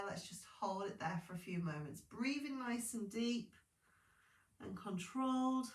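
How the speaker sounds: ordinary talk, nothing marked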